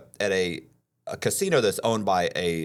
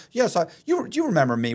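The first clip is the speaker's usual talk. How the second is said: pushy con man; 9 semitones higher, 3 dB louder than usual. Retold or imitated. Con man pressing the mark.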